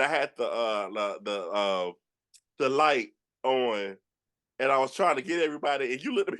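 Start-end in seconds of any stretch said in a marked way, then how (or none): none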